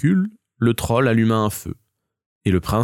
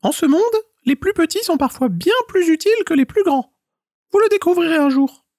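very high, squeaky little squirrel voice pleading; 5 dB louder than usual. A narrator's reading. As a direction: beg like a tiny trapped squirrel. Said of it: "répondit l'écureuil". (petite voix aiguë d'écureuil suppliant)

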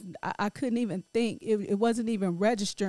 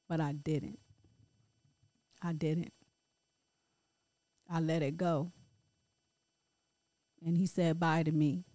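quiet grief; 5 semitones lower, 5 dB quieter than usual. Real, not acted. Mother remembering her son's last goodbye, quiet grief. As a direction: let the loss sink in, barely above a murmur.

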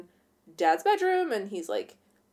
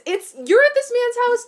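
incredulous; 14 semitones higher, 7 dB louder than usual. Real, not acted. Incredulous at the character.

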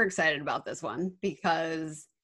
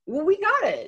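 put-on kids' voice; 12 semitones higher, 3 dB louder than usual. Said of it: "And they say".

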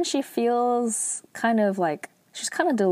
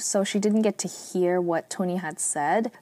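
normal talking voice

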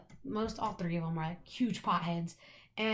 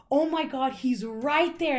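higher and louder, right there slowed; excited; 5 semitones higher, 6 dB louder, slower than usual. Retold or imitated. Stoned fan spotting her favorite rapper, thrilled. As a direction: gasp it out, starstruck.

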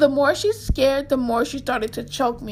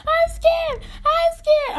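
much higher, mock-frightened whimper; imitating her panicking friend; scared friend; slower than usual. Friend clinging to her at the haunted house door, mock-frightened and much higher. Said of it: "She's like".

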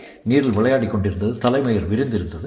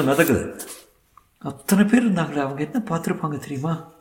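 higher, earnest woman's voice; lamenting young woman; 6 semitones higher than usual. Acted. Young woman telling Vasanth of the dead girl's lost wishes, lamenting. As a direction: list her lost hopes with pained feeling.

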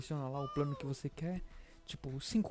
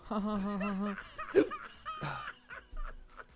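laughing; 7 semitones higher than usual. Real, not acted. Laughs at own hiccup.

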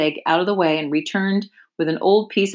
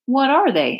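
mildly sad; 8 semitones higher than usual. Acted. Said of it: "asked Wilbur sadly".

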